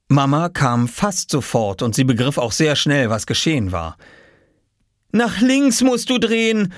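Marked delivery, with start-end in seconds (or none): 5.1-6.7 high, raised instructing voice; calling through door. A narrator's reading. Mother outside the door to her son, calling out. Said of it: "rief sie vor der Tür".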